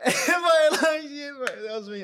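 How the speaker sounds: high laughing voice. Real, not acted. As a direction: laugh through it, amused at yourself. Laughing at own younger self.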